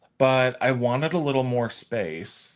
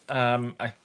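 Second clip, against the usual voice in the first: ordinary delivery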